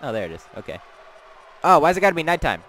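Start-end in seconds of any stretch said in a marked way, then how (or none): 1.6-2.6 louder, fast frustrated whine; loud complaint. Real, not acted. Player annoyed the game turned dark, loud. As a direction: blurt it out annoyed and quick.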